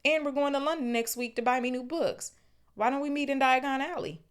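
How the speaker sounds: put-on outraged teenage boy; horrified Ron. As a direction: squeal it in dismay. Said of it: "said Ron in horror".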